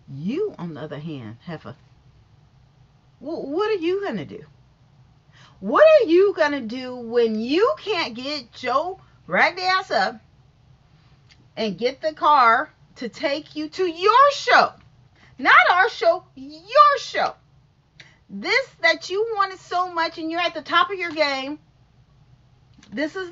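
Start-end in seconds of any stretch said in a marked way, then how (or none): 0.1-1.8 low, soft scolding jab. Real, not acted. 5.6-20.4 loud, high scolding rant. Real, not acted.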